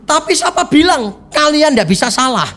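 high, insistent challenge; stubborn protest. Retold or imitated. Church member stubbornly rejects the witnesses, high insistent protest. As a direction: push back stubbornly against the witnesses. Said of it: "Tetap ngotot".

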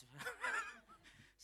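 slow, laughing through the punchline then a high laugh; chuckling at the muddy ending. Real, not acted. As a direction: laugh at your own mishap. Laughing at own muddy mishap.

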